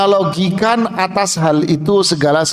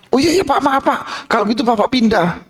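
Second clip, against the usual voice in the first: high, fast, flustered apology; apologetic waiter; 7 semitones higher, faster than usual. Acted. Waiter apologising to the complaining diner, high and fast. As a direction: rush out a nervous apology.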